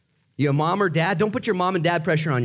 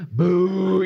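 louder, higher, drawn-out jeer; mock booing; 3 semitones higher, 4 dB louder than usual. Preacher jeering a job gone bad, playful booing. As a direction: boo it like a let-down crowd.

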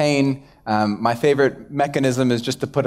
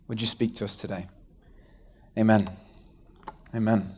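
hushed pastor's prayer; 4 semitones lower than usual. Acted. Pastor leads opening prayer.

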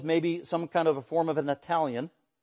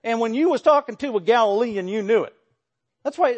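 lightly hammed-up uncouth Galilean twang; mock Galilean twang; 6 semitones higher, 4 dB louder than usual. Preacher mimicking unrefined Galileans, mock twang. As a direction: put on a bit of a backwoods Galilean accent. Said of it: "they had this twang and this accent".

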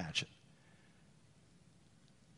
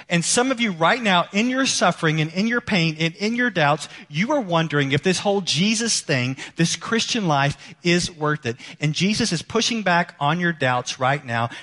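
raised, urgent sermon voice; earnest preaching; 4 semitones higher, 3 dB louder than usual. Acted. Pastor urging doubting listeners, raised and urgent. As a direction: lift and push toward the doubters.

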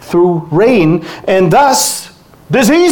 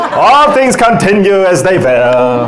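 lightly put-on Hebrew accent, a bit louder with a laugh; 2 semitones higher, 5 dB louder than usual. Shown as a demonstration. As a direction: read the verse in a playful Hebrew accent. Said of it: "I can do the Hebrew accent if you want".

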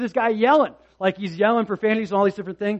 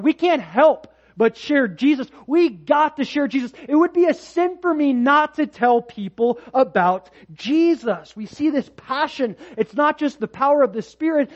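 high, loud, impassioned preacher delivery; fervent preaching; 6 semitones higher, 4 dB louder than usual. Real, not acted. Preacher urging the congregation to share Jesus, fervent preaching. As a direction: preach it out with rising urgency.

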